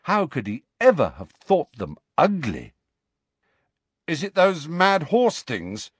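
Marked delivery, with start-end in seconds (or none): 4.1-5.9 uncertain. A narrator's reading. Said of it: "said Ron uncertainly".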